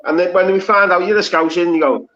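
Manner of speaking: ordinary talk, nothing marked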